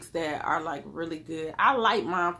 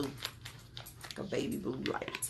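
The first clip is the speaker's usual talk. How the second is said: slow whisper; 4 semitones lower, 10 dB quieter, slower than usual. Real, not acted. Describing her vision.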